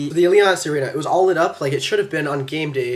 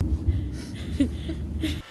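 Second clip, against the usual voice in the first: breathy giggle; 12 semitones higher, 9 dB quieter than usual. Real, not acted. Laughs about sister.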